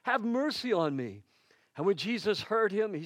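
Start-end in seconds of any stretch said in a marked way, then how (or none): 0.0-1.2 high, raised plea for mercy; insistent shouted begging; shouted louder. A narrator's reading. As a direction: call out insistently over the crowd. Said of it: "he only shouted louder".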